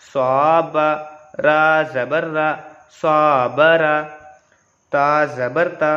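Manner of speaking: slow, even spelling-out chant; Qaida recitation. Shown as a demonstration. Teacher spelling words for students, slow recitation. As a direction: recite syllable by syllable for beginners.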